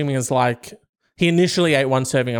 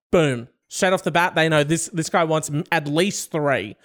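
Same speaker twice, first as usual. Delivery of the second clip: ordinary talk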